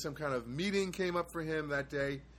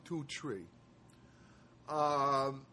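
normal talking voice

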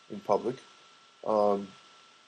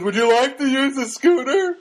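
helpful store guy; 11 semitones higher, 5 dB louder than usual. Retold or imitated. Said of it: "he comes up to me and goes".

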